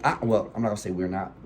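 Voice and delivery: ordinary delivery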